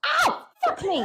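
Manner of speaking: sudden high-pitched yelp of pain. Real, not acted. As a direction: cry out as if just burnt. Hurt by burning incense, yelps.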